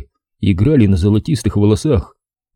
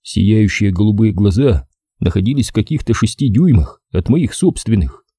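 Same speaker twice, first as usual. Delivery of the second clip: plain reading voice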